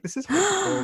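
high-pitched retold hurt protest; mock offended. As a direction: play up being wounded, high-voiced. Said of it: "I feel quite offended by this".

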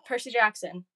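ordinary talk, nothing marked

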